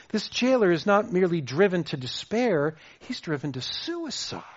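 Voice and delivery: ordinary delivery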